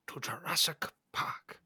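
breathy whispered movie quote. Pretend. Friend whispers the famous Jurassic Park welcome line.

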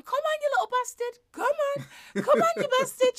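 put-on toddler coaxing again. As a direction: keep coaxing in a child's voice. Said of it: "she just kept going".

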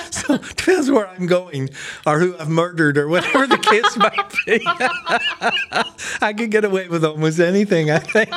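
giggling at his own dark joke; laughing through a joke. Real, not acted. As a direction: crack yourself up mid-sentence. Joke about murder and passports.

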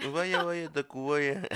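high, playful singsong chant; high goofy catchphrase. Pretend. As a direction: pitch it up and play it silly. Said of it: "dilo como tú lo dices siempre".

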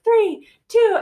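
high, loud mock countdown; imitating a guide's high-pitched count; retold countdown. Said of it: "I remember him counting down".

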